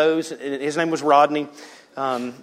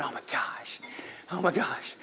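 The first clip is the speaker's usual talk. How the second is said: hushed dread; 12 dB quieter than usual. Retold or imitated. Boy watching friend's punishment.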